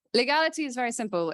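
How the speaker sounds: ordinary talk, nothing marked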